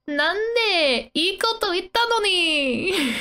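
high, drawn-out pleading character voice; mock agony. Manga girl protests being hit, mock agony. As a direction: plead in an acted high-pitched wail. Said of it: "in utter agony".

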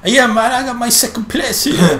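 high, loud cocky racer voice; voicing the rival racer, high and loud; mock Tom voice. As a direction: brag like a smug rival.

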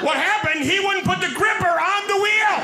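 very high, strained comic shriek; mock outraged yell. Passenger answering sheriff about driver, high mock-outraged yell. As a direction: throw the question back in hammed-up fury.

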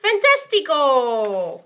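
loud, slow, drawn-out cheer; excited praise. Acted. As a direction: shout it out like a game show. Praise after answer.

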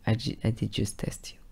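soft, whispered confession of a trap; cold whisper. Retold or imitated. Telling his girlfriend he only tested her, whispered. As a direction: let it out quietly, almost smug.

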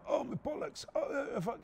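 a touch of winded pain; pained groaning. Retold or imitated. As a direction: feebly imitate being in agony. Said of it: "I'm not saying it like I'm now".

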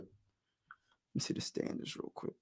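under his breath, whispering to himself; whispered aside. Real, not acted. Streamer checking the standings, whispering aside to himself. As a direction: whisper it, half to yourself while checking.

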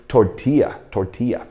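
tortilla with a Y sound in place of LL; double L as Y. Shown as a demonstration. Teacher shows the class tortilla, LL sounded as Y. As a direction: say tortilla with LL pronounced like Y. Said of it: "L L发Y的音".

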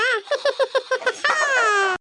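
long unhinged cackle; crazy laugh. Acted. Aunt laughs madly beside her crying daughter, unhinged cackle. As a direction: laugh like you've lost it. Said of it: "கிறுக்கு மாதிரி சிரிச்சுட்டு கிடக்காங்க".

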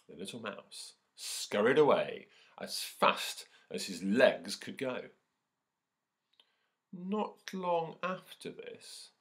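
slow, expressive story reading; lively read-aloud for an assembly; storytelling to children. A narrator's reading. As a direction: read it like a picture book to the class.

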